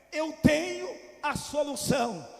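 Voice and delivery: preacher proclaiming Jesus's answer to the widow; proclaimed promise. Acted. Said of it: "O que Jesus estava dizendo".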